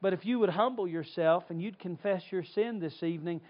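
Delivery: ordinary delivery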